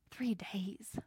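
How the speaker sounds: breathy whispered emphasis; hushed stress on "Three days". Real, not acted. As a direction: whisper it to make it sink in. Stressing the long journey.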